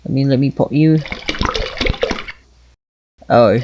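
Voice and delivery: half-hearted British accent while playing. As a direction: chat along in a mock British accent. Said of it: "Gonna do the rest of this with a British accent".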